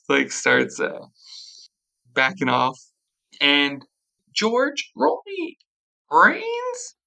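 normal talking voice

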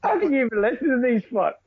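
high-pitched, laughing disbelief; mock incredulity. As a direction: ask it high, in exaggerated disbelief. Mock disbelief at a rival show.

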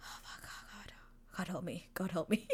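barely audible, whispered dismay; mock dread. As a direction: whisper it like his helpless inner plea. Voicing his dread at the request.

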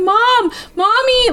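high, pleading call for mom; upset child crying for mom. Retold or imitated. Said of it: "a moment where they're just like".

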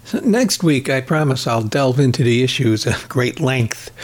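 normal talking voice

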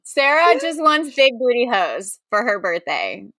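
usual speaking voice

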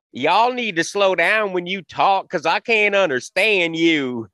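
slow, high-pitched Southern backwoods drawl; hillbilly accent. Retold or imitated. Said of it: "down south backwoods hillbilly".

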